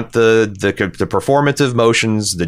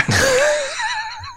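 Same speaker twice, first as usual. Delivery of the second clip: laugh; 4 dB quieter than usual. Real, not acted. Laughing at his accent attempt.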